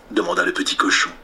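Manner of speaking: plain reading voice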